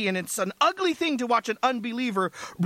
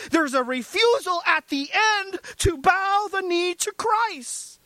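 fervent preaching; 10 semitones higher than usual. Acted. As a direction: preach it at full force. Preaching climax about the criminal.

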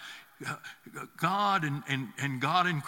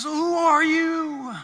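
slow, loud, much higher cry of awe; Moses calling out; 11 semitones higher, 8 dB louder, much slower than usual. Acted. Moses crying out to the voice in the bush, loud and slow. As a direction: call out into the fire, stretched and astonished.

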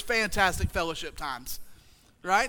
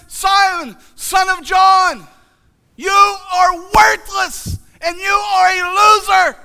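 high, loud, slow, hammed-up harsh verdict; mock condemning shout; 8 semitones higher, 11 dB louder, slower than usual. Preacher voicing an imagined Jesus condemning Peter, hammed-up shouting. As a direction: bellow the put-down, drawn out, mocking the imagined judge. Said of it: "He doesn't say that at all".

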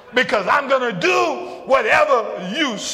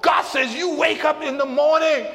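full-force preaching; 4 semitones higher than usual. Acted. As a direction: build the sermon toward the climax. Preacher voicing God, building.

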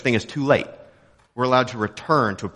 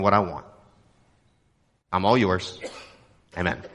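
repeat-after-me prayer; 4 semitones lower than usual. Shown as a demonstration. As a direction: pray it aloud in short pieces for people to repeat. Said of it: "I'm gonna pray it out loud".

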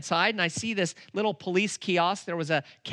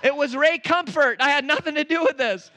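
higher, louder, laughing through it; excited laughing; 10 semitones higher, 7 dB louder than usual. Real, not acted. Preacher telling the church, excited and laughing. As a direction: share the joke with the crowd.

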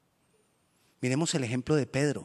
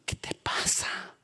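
slow whispered reproach; gentle rebuke; 5 dB quieter, much slower than usual. Acted. Jesus quietly rebuking Peter, slow whisper. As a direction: hush him softly.

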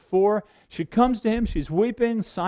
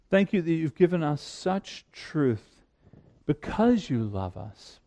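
prayer; 6 semitones lower than usual. Acted. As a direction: pray gently over the congregation. Leads the congregation in prayer.

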